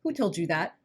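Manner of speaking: ordinary talk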